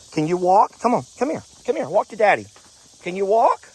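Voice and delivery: high, encouraging pet talk; coaxing. Real, not acted. Farmer urging his hurt goat to walk to him, high coaxing.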